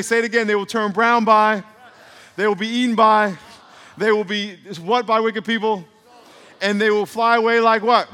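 loud, high drill prompts; call and response. Real, not acted. Preacher drilling the congregation, loud and high. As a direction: lead the crowd through the words.

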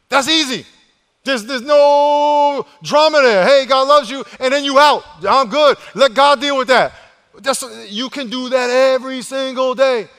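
shouted, high-pitched preaching; full-force preaching. Real, not acted. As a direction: fire up the congregation, stretching key words loudly.

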